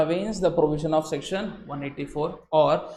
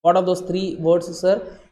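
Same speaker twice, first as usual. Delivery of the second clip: usual speaking voice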